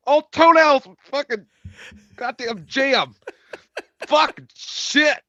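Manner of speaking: strained and high, slowly; reacting to sour beer. Real, not acted. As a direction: choke the words out after a sour sip. First sip of very sour beer.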